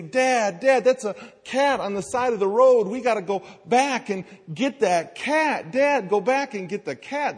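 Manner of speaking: put-on pleading kids. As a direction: beg like excited kids spotting a cat. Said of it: "They say".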